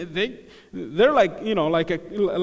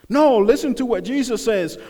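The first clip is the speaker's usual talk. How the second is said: normal talking voice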